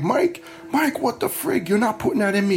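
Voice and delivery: high, alarmed outcry at the nurse; panicked protest. Retold or imitated. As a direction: panic as the IV starts smoking.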